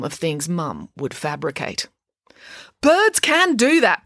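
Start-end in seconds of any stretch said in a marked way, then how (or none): 2.8-4.0 high, loud, slow protest. A narrator's reading. Mum insisting to Tammy that birds can, protesting. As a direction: insist loudly, stretching the words. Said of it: "protested Mum".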